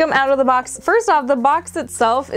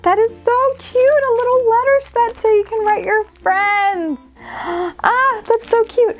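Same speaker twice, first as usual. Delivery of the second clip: high, delighted cooing; gushing; 7 semitones higher than usual. Real, not acted. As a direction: coo over it in a raised pitch. Gushing over the letter set.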